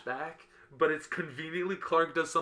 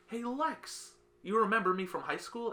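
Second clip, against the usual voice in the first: mock eager Clark; 9 semitones higher than usual. Clark greets Lex at LexCorp.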